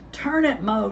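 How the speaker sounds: urgent. Acted. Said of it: "Dale said".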